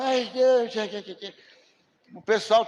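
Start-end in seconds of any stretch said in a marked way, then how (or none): 0.0-1.3 imitating a reluctant churchgoer's sigh; whiny church member. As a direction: groan it like someone too tired to bother. Pastor mimics a complaining member.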